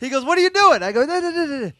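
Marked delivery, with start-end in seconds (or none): 0.2-0.8 high, loud, startled question; alarmed protest. Retold or imitated. Startled man as stranger barges in. 1.0-1.7 high-pitched retold string of denials; hasty placating. Hurriedly reassuring the startled man.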